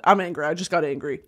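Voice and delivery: normal talking voice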